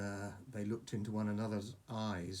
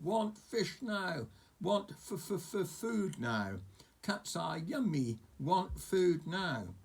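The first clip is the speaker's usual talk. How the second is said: high, slow, stretched dragon wail; a little dragon throwing a tantrum; shouting dragon; 7 semitones higher, much slower than usual. Acted. Struggling dragon shouting for food, slow and high.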